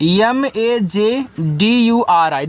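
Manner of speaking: slow letter-by-letter spelling; teacher spelling out a word for students. Shown as a demonstration. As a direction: spell out each letter slowly and deliberately. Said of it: "देखो इसको पढ़ते हैं मजदूरी".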